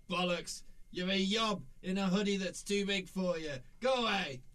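lightly British grandfather; crusty old British granddad; British accent. Acted. Grandfather scoffs at Death in a light British accent. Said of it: "Why does your grandfather talk in a British accent".

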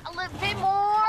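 loud effort, last word stretched; straining. Acted. Kat guiding Jen moving the fridge, loud strain. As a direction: force it out mid-heave.

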